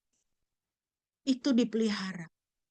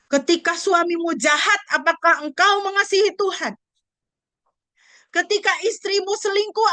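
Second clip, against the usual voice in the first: louder, higher preacher's challenge with a cry; impassioned preaching; 6 semitones higher, 4 dB louder than usual. Acted. Preacher challenging the congregation's love for God, full force. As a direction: press each question on the hearers.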